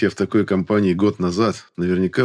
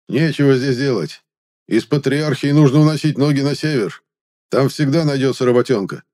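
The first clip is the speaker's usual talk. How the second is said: gruff mercenary; 7 semitones higher, 3 dB louder than usual. Acted. Mercenary argues over map.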